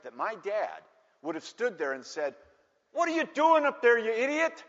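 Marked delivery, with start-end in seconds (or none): 2.9-4.6 high, loud mock-furious dad; angry scolding. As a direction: bellow up at the kid in exasperation. Imagined dad scolds his son.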